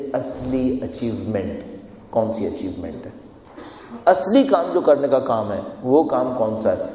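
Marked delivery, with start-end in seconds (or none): none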